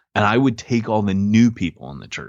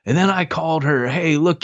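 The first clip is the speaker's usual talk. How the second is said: higher-pitched, worked-up quote; excited retelling; 8 semitones higher than usual. Retold or imitated. Man recounting his victory to the Bible study, excited. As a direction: imitate him telling it, voice lifted. Acting out man's excited story.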